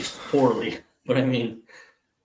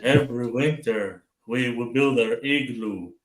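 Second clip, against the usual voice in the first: slow, earnest lightly Canadian drawl; a hint of Canadian accent; mock Canadian accent; slower than usual. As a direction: play the proud Canadian, deadpan and slow. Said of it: "and he's like".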